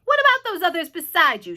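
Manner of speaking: police chief calling loudly; shouted question. A narrator's reading. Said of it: "shouted the chief of police".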